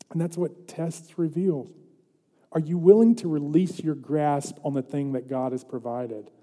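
normal talking voice

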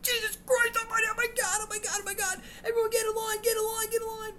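very high, loud frantic shouting; panicked shrieking. Retold or imitated. Substitute screaming at kids during a fire drill. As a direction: shriek orders in total panic.

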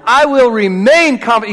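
remain pushed loud; stress on remain. Shown as a demonstration. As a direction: lean on the word remain. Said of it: "You gotta get the emphasis on the right place".

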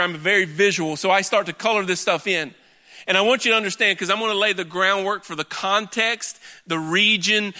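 ordinary delivery

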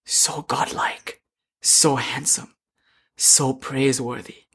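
slow, breathless half-whisper, awed. Retold or imitated. Mother, stunned by Shiva's beauty, in an awed half whisper. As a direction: murmur it as if smitten. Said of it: "in a half whispered tone".

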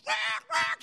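high imitated parrot squawk; squawking bird. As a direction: squawk like a panicking macaw. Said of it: "He's flapping and squawking".

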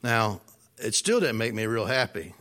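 usual speaking voice

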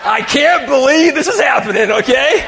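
loud, high comic outcry; exasperated disbelief. Acted. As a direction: cry it out in disbelief. Acts out his disbelief loudly.

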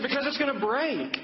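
high, insistent retold child's protest; urgent daughter. Daughter warns dad about the glass, high-pitched urgency. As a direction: imitate a child pleading the obvious.

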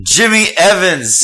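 loud, high, drawn-out name announcement; triumphant stretched-out reveal; big reveal. Pretend. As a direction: shout the winner's name like an announcer. Announces winner after drum roll.

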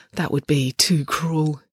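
usual speaking voice

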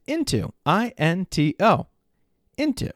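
high, proud final word; triumphant spelling. Retold or imitated. Father proudly spelling the word for his son. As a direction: nail it with glee.

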